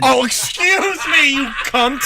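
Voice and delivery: slow, sarcastic mock apology. Real, not acted. Teasing a friend over his age, slow sarcasm. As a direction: drag it out with sarcasm.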